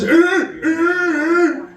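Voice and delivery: high-pitched seal cry; seal noise. Retold or imitated. Comedian imitating a clubbed seal, high-pitched yelp. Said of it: "it's like clubbing a seal".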